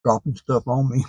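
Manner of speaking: words around a mouthful; talking while chewing. Real, not acted. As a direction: mouth partly full of food. Seen 2: Lips pursed and moving around food after the earlier burger bite.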